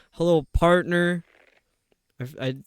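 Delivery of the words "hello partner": louder, drawn-out cowboy drawl; cowboy accent. Shown as a demonstration. Streamer rereading a chatter's greeting, hammed-up cowboy accent. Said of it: "read it like a cowboy".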